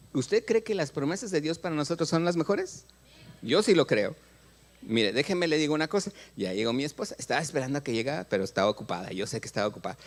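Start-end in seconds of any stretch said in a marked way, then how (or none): none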